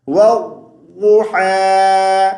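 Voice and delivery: والضحیٰ with the waw joined to a doubled ضاد, slow and drawn out; correct tajweed. Shown as a demonstration. Teacher shows his students the right والضحیٰ. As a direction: join the waw straight onto a held, doubled ضاد in والضحیٰ. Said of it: "واو کو ضاد کے ساتھ ملاتے ہوئے".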